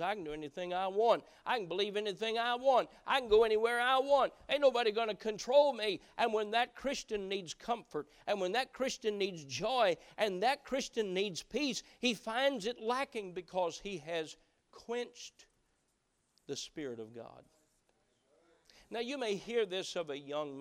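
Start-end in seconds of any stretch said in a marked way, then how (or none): 0.0-6.0 loud, fast defiant bragging; defiant boast. Acted. 6.2-17.5 loud, high preaching voice; full-force preaching. Acted. Preacher challenges the congregation about quenching the Spirit, full-force preaching.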